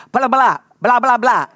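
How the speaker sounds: imitating a two-year-old's babble; toddler babble. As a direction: mimic a toddler's baby talk, quick. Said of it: "那儿子才两岁 他就讲".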